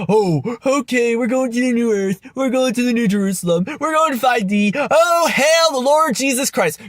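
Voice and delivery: high, gushing mock believer voice. Vlogger imitating New Age believers bound for new earth, mocking. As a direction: mimic gullible zeal, building to a shout. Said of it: "I'm mocking your religion".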